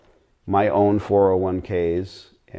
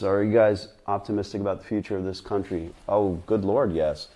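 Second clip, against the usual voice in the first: ordinary talk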